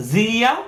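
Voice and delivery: জিয়া said high with a rising tune; teacher drilling জিয়া with a rise; rising jia. Shown as a demonstration. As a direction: lift the pitch on জিয়া, jee-a. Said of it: "যা".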